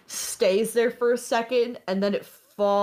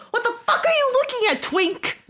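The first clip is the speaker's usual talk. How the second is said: high, loud, rapid-fire scream; sudden aggressive yell, very high and fast; screaming threat; 4 dB louder, faster than usual. Acted. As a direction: scream it at someone, pitched way up. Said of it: "person she screamed at before".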